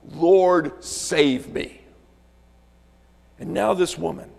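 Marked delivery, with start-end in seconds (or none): 0.1-1.8 loud, acted plea for rescue; crying out. Preacher voicing Peter sinking in the water, crying out loudly. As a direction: call out for help, loudly. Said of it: "he uses that tone of voice when he cries out".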